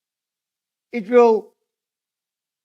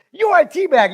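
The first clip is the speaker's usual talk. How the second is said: high, loud mock-serious declaration; mock-serious insult; 10 semitones higher, 10 dB louder than usual. Somebody insulting a listener in all seriousness, mock-serious and loud. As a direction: deliver the silly insult loudly, as if deadly serious. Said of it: "will come to you in all seriousness".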